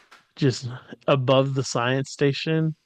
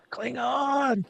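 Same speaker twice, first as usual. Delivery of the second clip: a breathy little echo, much higher; high, soft mock echo; 9 semitones higher, 8 dB quieter than usual. Mocking the crew, a high hushed Klingon.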